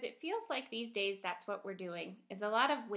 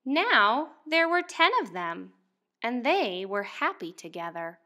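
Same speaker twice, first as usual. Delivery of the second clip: louder, lively picture-book read-aloud; reading to children; 12 dB louder, slower than usual. Acted. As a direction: read it out to a class of first graders. Teacher reads picture book aloud.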